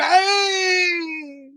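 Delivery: high, long-drawn scream, mimicking a girl; girlish scream. Imitating a screaming man in a retold prank clip, high girlish scream. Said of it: "he was screaming like a girl".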